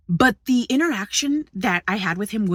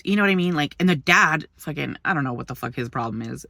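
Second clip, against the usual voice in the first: usual speaking voice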